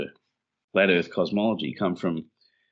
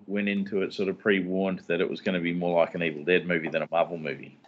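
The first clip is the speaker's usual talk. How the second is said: ordinary delivery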